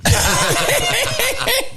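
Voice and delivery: a high burst of laughter; giggling burst. Real, not acted. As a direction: laugh at your own disaster trip. Laughing about Ibiza trip.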